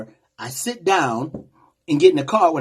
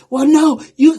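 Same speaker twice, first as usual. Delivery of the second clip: high, louder mock yell of an angry woman; 10 semitones higher, 6 dB louder than usual. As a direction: cut him off with a high, loud yell. She is screaming and yelling.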